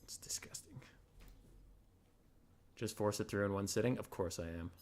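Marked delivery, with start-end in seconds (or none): 0.0-0.6 whispered in disgust. Real, not acted. Streamer whispering about his slurping dog, disgusted whisper. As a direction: hush it out, grossed out.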